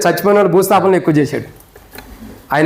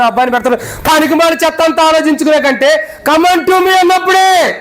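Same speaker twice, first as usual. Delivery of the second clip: raised, impassioned pulpit voice, driving each question home; preaching at full force; 6 semitones higher than usual. Acted. Pastor to funeral congregation, preaching at full force.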